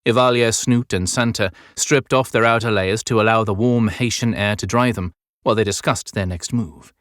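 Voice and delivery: ordinary delivery, nothing marked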